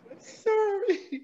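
high, slow, strained I'm sorry; barely holding back tears; tearful apology. Real, not acted. Guest apologizes to host, choked up and tearful. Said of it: "I'm getting emotional".

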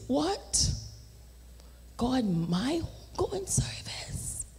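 soft, slow, disbelieving whisper; stunned whisper. Retold or imitated. Answering God at the funeral, stunned whisper. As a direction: whisper it in shock.

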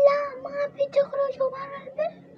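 high, sharp refusal from the mother-in-law; mother-in-law refusing. Acted. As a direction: voice her forbidding them to leave. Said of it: "أم أحمد قالت". (حماة ترفض بصوت حاد ومرتفع)

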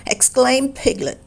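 ordinary delivery

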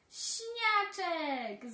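high, loud, slow drawn-out call; children shouting the name with delight; joyful children's cry; excited calling. Acted. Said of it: "zawołały dzieci".

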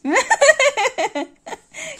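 bursts out laughing high; big high-pitched laugh; loud laugh. Real, not acted. Giggling at partner's answer.

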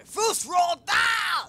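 huge, high, slow battle shout; dragon shout. Shown as a demonstration. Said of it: "In other words".

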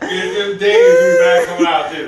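high, loud laughing joke, slow. Real, not acted. Pepper eater jokes about the bathroom: loud high laughing.

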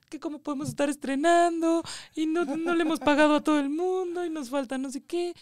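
high, tearful mock lament; reliving the panic of owing everyone, half-crying; retold crying. Producer to herself before the premiere, half-crying lament. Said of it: "yo iba así llorando".

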